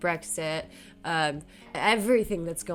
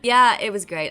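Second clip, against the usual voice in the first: sarcastic; 3 semitones higher, 10 dB louder than usual. Real, not acted. Rent raised almost fifty percent.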